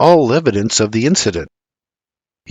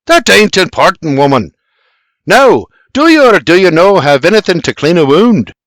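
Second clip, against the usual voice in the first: loud, raised exasperated insistence; impatient demand; 5 semitones higher, 6 dB louder than usual. A narrator's reading. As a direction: cut her off and press her. Geoffrey impatiently cuts her off.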